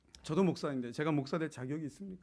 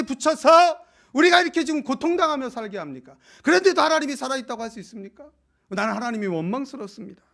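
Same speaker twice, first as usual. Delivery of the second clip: loud, high, accusing outcry at God; bitter complaint; 11 semitones higher, 16 dB louder than usual. Acted. Gideon complaining to God's messenger, loud and bitter. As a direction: hurl the grievance upward, voice raised. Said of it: "하나님의 대리자 앞에 원망을 합니다".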